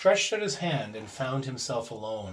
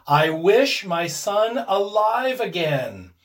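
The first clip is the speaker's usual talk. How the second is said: slow, loud, raised incantation; solemn wish; 5 semitones higher, 7 dB louder, slower than usual. A narrator's reading. Old man raises the paw and wishes, solemn. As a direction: pronounce it slowly and deliberately.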